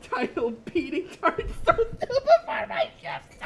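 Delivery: high, loud laughter through the title; laughing hard. Real, not acted. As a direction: cackle out the joke title. Laughing reading title joke.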